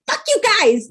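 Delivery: high, loud mock rant at everyone; playful fake fury; mock outburst. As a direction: fling it out like a bitter person, half joking.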